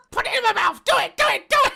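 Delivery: high shrieking puppet imitation; squeaky puppet voice. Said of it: "mimicking the puppet line".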